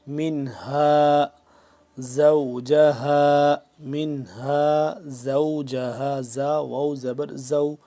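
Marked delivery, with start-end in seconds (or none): 0.1-6.2 زوجہا with a hard, firm زا. Shown as a demonstration. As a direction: hit the زا of زوجہا firmly, slow chant. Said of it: "اس کو ہم نے سخت کر کے پڑھنا ہے زا کو".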